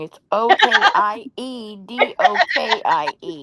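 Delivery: O K I E D O K I E spelled out slowly, letter by letter. Shown as a demonstration. Correcting the spelling for her friend, slow letter-by-letter spelling. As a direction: spell O K I E D O K I E clearly, one letter at a time. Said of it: "That's because you spelled it wrong".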